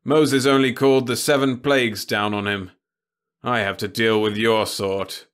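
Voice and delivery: a heavy man talking down to a pest; gruff official voice, loud and disdainful; scornful director. A narrator's reading.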